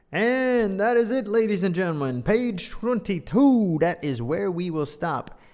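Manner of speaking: loud, high showman wrap-up; grand "ladies and gentlemen" finale; announcer sign-off. Acted. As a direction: close the show like a host.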